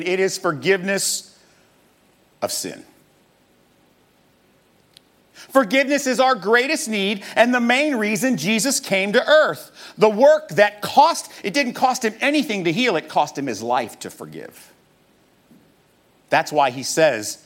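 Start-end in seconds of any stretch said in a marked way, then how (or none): 5.3-14.7 high, forceful preacher's delivery; emphatic preaching. Real, not acted. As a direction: drive the point home from the pulpit, pitched high. Preaches the main point.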